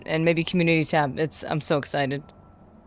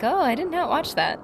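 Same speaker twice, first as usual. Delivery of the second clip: indignant; 7 semitones higher than usual. Retold or imitated. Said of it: "I'm like".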